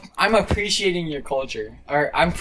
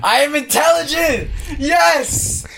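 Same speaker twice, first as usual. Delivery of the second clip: triumphant shout; 12 semitones higher, slower than usual. Real, not acted. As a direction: yell it like you just won. Triumphant after being mocked.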